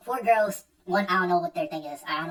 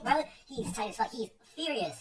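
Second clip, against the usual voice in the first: ordinary delivery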